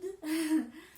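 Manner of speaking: loud high giggle; sudden laughing fit; burst of laughter. Real, not acted. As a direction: laugh loudly at yourself. Laughing at her own demo.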